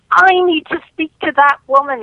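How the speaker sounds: put-on host yelling on air in a British accent for the caller. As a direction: bellow it across the airwaves in a British accent. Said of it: "he yells in his British accent".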